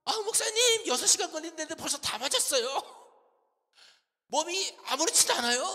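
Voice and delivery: very loud, high-pitched imitation of a delighted patient; hammed-up cheerful phone call; chirpy, beaming voice. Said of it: "목소리가 생글생글이야".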